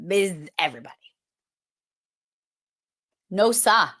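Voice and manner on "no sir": "sah" with no R, like "sah"; Boston "No, sah". Shown as a demonstration. Teacher demoing a local phrase, Boston accent. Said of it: "It's a local way of saying".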